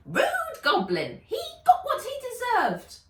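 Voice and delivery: very high, loud scolding; indignant elf. Acted. Elf scolding the rat-turned goblin, high and loud. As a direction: huff at the vanished goblin. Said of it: "She turned her attention back".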